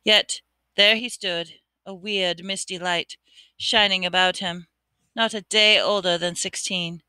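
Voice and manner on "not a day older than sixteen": ordinary delivery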